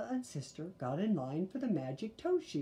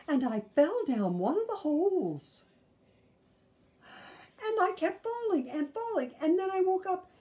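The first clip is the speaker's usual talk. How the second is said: put-on high little-girl voice, tumbling out her nightmare; frightened little sister; 10 semitones higher, 5 dB louder than usual. Sister tells Mama and Papa her nightmare, high scared child voice. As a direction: tell the dream high like a scared cub.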